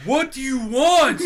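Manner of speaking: the orc roars it out slowly at the tiefling; orc's gruff demand. Acted. Orc guard bellowing at the staring tiefling, slow and loud. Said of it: "Like that".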